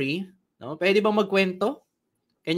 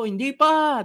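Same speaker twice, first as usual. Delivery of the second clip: crowd shouting back; 8 semitones higher than usual. Retold or imitated. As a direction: yell "Hindi pa" as the eager audience. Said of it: "sigawan yung mga tao".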